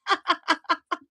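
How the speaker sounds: real high giggle; a bright burst of laughter. Real, not acted. Artist laughing at her own imitation, high giggle.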